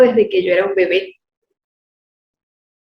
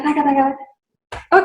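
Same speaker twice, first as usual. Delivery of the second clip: rapid nonsense syllables imitating dubbed dialogue; mimicked lip sync; 4 semitones higher than usual. Shown as a demonstration. Actress showing how she dubbed the scene, rapid babble. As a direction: rattle off taca taca like dubbing lines fast. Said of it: "Y yo, taca".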